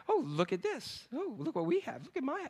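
very soft, cooing show-off; mock boasting. Boastful friends show off what they have, softly mocking. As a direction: softly coo over your own things. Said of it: "they boast and everything".

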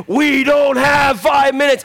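slow, loud gritty shout; Kiefer voice. Retold or imitated. Preacher as Jack Bauer refusing to wait, shouting. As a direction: hammer each word like a desperate hero. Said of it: "in my best Kiefer voice".

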